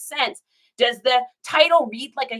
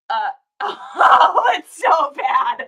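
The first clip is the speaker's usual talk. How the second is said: loud, higher laugh-cry of embarrassment; laughing cringe; 3 semitones higher, 6 dB louder, much slower than usual. Real, not acted. Reacting to her old description.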